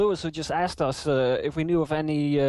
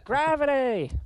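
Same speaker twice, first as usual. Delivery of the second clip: exasperated shout; 11 semitones higher, slower than usual. Real, not acted. Mocking the truther caller.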